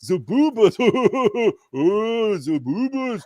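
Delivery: high, goofy giggling dimwit voice; hammed-up silly fan voice with dopey laughs; imitating juvenile fans. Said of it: "A P's fans will be going".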